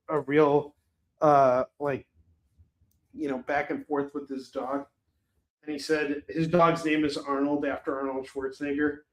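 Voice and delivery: ordinary talk, nothing marked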